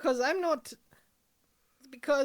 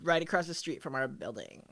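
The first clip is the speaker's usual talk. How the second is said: put-on lower, dismissive teen girl; 9 semitones lower, 4 dB quieter than usual. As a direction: brush her off, bored. Girls brushing off the neighbor.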